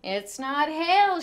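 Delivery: put-on boy shouting over the noise. A narrator's reading. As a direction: insist loudly. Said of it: "shouted Danny".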